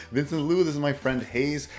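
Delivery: ordinary talk, nothing marked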